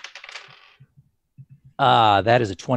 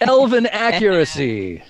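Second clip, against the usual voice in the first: slow, loud, proud emphasis; grand boast; 3 semitones higher, 4 dB louder, slower than usual. Acted. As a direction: announce it like a proud title. Boasts his accuracy.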